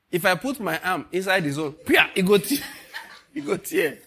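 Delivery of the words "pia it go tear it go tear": laughing through the joke. Real, not acted. Pastor joking that the cloth would tear, laughing. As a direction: chuckle out the punchline.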